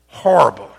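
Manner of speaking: Horrible hit hard and loud as a one-word line; emphatic repeat. Real, not acted. Pastor tells the church about Jerry's terrible day, Horrible punched loud.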